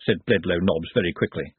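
ordinary delivery

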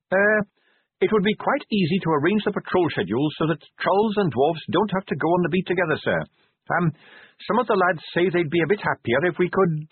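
put-on earnest young officer, keen and respectful, slightly quick. A narrator's reading.

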